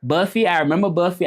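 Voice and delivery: ordinary talk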